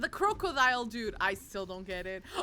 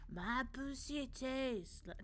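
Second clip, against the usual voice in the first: slow, softer half-hearted politician take; a hesitant try at a presidential voice, drawn out; Trump impersonation; 7 dB quieter, slower than usual. Retold or imitated. Streamer giving up on an impression, half-hearted voice. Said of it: "Trump impersonation".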